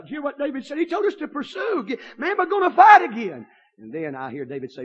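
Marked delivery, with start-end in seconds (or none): 0.0-3.5 rising, higher, louder call to fight; eager soldier. Acted. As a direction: fire up the men. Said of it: "I see him say".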